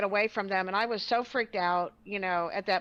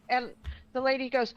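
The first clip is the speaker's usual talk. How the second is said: ordinary delivery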